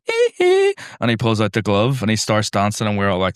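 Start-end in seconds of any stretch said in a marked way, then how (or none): 0.1-0.8 high hee hee. Retold or imitated. The dancing man copying [name], squeaky high yelp. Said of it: "he goes".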